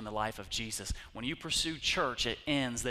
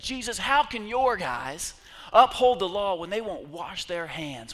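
mock freaking out; 5 semitones higher, 6 dB louder than usual. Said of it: "freaking out".

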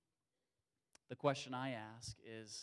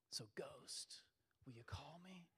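soft prayer; 12 dB quieter than usual. Acted. Praying softly to the ghost.

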